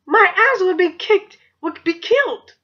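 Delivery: high, dramatic emphasis; emphatic dread. Real, not acted. Teen explaining her strict mom, emphatic high-pitched warning.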